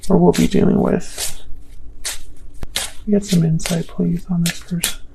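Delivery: softly asking, almost inaudible; murmured plea. Real, not acted. Murmuring a request to Spirit over the cards. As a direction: murmur it under your breath.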